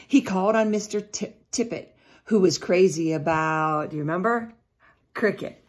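ordinary delivery, nothing marked

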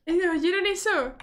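lenta, aguda y más fuerte; alarmada. Real, not acted. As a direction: pregunta inquieta tras el ruido. Startled by a ghostly noise. (pregunta alarmada, aguda y lenta)

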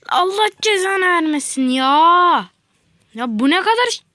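exasperated yell at the game; frustrated outburst. Real, not acted. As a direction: let the frustration burst out. Raging at the game.

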